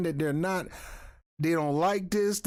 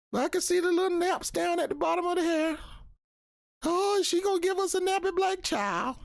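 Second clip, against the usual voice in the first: high, sneering mock-posh voice, much higher; mocking the royals; 14 semitones higher than usual. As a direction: lampoon a snooty royal fretting over the baby. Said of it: "she talked about how they was worried about".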